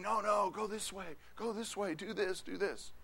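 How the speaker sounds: soft mock crowd, hushed; hushed imitation of a crowd; crowd voices. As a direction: voice the pushy crowd softly. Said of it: "the crowd saying".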